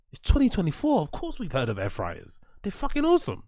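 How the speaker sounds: loud, high-pitched incredulous retort; exasperated mock reply. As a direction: burst out at someone stating the obvious. Exasperated reply to stale discovery.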